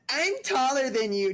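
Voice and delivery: put-on high, taunting kid voice; bratty little kid. Mocking a kid standing on a chair, high taunt. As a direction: boast like a small child. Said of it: "it's like the little kid".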